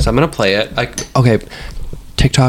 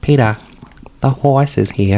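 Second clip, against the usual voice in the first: Lois impression again. Shown as a demonstration. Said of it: "Can you do any other impressions".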